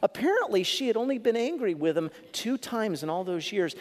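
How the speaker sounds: ordinary talk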